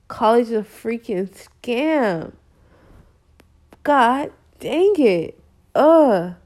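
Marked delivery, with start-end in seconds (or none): 3.8-6.3 slow, exasperated outburst ending in a loud ugh; fed-up groaning exclamation; frustrated. Real, not acted. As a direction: let real irritation burst out, drawn out. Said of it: "I'm so frustrated".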